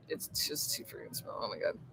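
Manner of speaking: a soft, breathy whispered complaint. Real, not acted. Vlogger confiding to viewers about the small town, whispering. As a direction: drop to a whisper.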